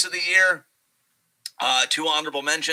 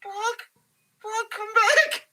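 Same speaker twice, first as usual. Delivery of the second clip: much higher, tearful, slow plea; Paul's despair; much slower than usual. Retold or imitated. Paul Heyman crying out for Brock as Roman attacks, much higher and tearful. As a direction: whimper for your lost protector. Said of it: "Paul's just looking like".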